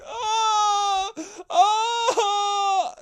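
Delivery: high, loud mock wail of fake grief; mock wailing scream. Fake mourners screaming at the funeral, mock wail. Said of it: "just started screaming".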